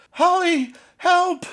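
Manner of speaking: very high, loud scream; a last desperate cry; crying for help. Acted. Gail screaming to Holly as she turns to a doll. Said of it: "As Gail cried out".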